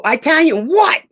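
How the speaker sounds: high, loud, slow "Italian what?"; incredulous retort. Retold or imitated. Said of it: "I go".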